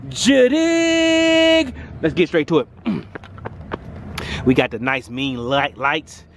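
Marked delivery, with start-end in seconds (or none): 0.1-1.7 high, held-out catchphrase. Acted. Signing off the point with his catchphrase, high. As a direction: sing it out, much higher. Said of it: "as I like to say".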